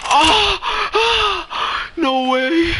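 shrieks way up high, then gasps; a jolt of disbelief, loud and high; shocked scream. Real, not acted. Gamer shrieking with shock at a big in-game hit.

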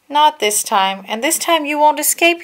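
high, loud defiant reply; Obi-Wan defiant. A narrator's reading. Obi-Wan defies General Grievous, loud and high. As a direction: answer the villain boldly. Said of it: "he said".

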